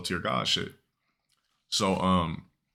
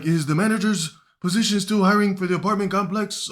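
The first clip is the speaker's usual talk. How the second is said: much higher, louder put-on voice; high, urgent helper voice; teacher asking his girlfriend about a job opening, in a high put-on voice; eager teacher on the phone; 11 semitones higher, 4 dB louder than usual.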